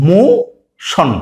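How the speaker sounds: Motion stretched very slowly; drawn out. Shown as a demonstration. Teacher slowly models motion for students. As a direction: draw out motion syllable by syllable. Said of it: "শব্দটা কি হয়ে গেল".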